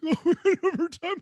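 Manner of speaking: high, loud, laughing imitation; mock lament. Host mimicking running overtime, laughing high mock voice. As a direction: whoop it through laughter, poking fun. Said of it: "So you can't just be like".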